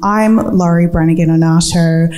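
usual speaking voice

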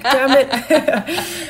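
a joking groan through laughter; lightly hammed-up annoyance while laughing; mock dismay, laughing. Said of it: "And I was like".

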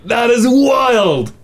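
amazed reaction. Real, not acted. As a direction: stretch it out, marvelling at the story. Host reacts to caller's story.

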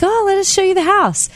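high, chirpy mock invitation; eager hosts. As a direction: imitate keen neighbors offering a tour. Eager neighbors offering a tour.

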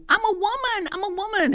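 hammed-up high woman voice; mock falsetto. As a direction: ridicule him with a squeaky high voice. Mocking a man's claim.

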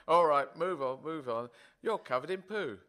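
hammed-up farmer yelling at cows; mock cowherd shout. Cowherd shooing the cows along, mock loud.

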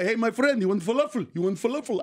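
put-on falafel vendor with a Middle Eastern accent; light Middle Eastern street-vendor voice. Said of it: "I learned the Middle Eastern accent".

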